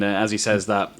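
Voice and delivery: normal talking voice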